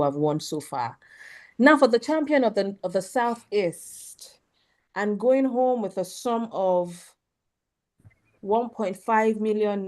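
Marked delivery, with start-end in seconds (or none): 1.6-8.7 booming prize announcer. Acted. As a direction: announce the winner with suspense.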